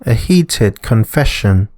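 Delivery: steady storybook narrator reading the plot; story narration. A narrator's reading. Narrator recapping Dag's investigation, calm storytelling.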